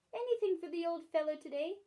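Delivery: wheedling. Acted. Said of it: "in a soft, wheedling voice".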